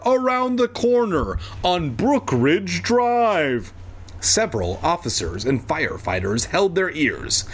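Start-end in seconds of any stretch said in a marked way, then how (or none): 0.0-3.7 high, slow, stretched-out call; shouting down. A narrator's reading. Evan shouting down to the officer, high slow holler. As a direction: holler directions down, dragging the words. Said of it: "he shouted down to the officer".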